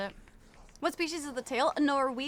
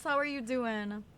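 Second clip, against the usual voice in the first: normal talking voice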